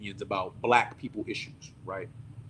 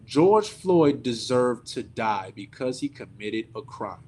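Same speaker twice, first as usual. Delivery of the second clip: slow quote; 3 semitones lower, slower than usual. Retold or imitated. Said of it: "and I quote".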